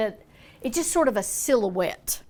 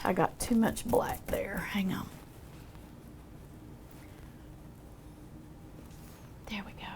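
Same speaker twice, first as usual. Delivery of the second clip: whisper; 10 dB quieter than usual. Real, not acted. Painter mutters over a mistake.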